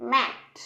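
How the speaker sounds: mat with a short a. Shown as a demonstration. Teacher models ম্যাট with a short a. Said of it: "বলো, উচ্চারণ করো".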